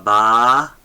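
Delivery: "ba" with a long, held aa; teacher sounding out a long "ba"; long vowel demo. Shown as a demonstration. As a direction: draw out the vowel in "ba", loud. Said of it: "with the skapa".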